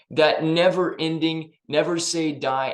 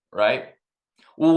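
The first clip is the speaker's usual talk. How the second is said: ordinary talk, nothing marked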